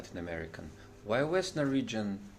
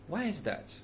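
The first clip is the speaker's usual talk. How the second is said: ordinary delivery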